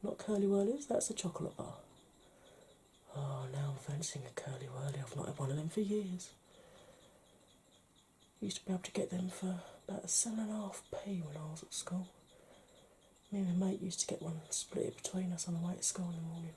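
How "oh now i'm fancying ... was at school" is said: soft confiding whisper. Acted. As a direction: whisper the memory to the viewer. Whispered nostalgic aside.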